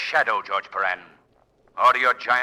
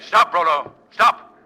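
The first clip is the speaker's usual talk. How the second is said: loud forceful order; commanding shout; 7 semitones higher, 11 dB louder, slower than usual. Acted. Hero ordering the giant to stop, commanding shout.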